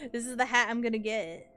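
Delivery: normal talking voice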